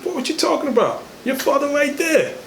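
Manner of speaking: high-pitched mock mother scolding her boy. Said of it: "She grabbed him and said".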